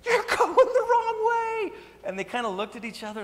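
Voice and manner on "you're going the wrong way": very high, slow shrieking yell with a laugh; hammed-up roadside shriek. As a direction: screech it like a panicked wife. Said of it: "they're just like".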